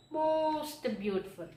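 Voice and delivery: most lifted high and hit; stress on most. Shown as a demonstration. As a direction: punch the word most. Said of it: "teacher stresses most".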